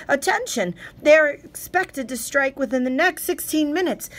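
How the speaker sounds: high, loud emergency broadcast shout; urgent shouted alert. Acted. Radio announcer shouting a missile warning to listeners, urgent. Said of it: "the announcer almost shouted".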